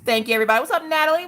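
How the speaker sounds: ordinary delivery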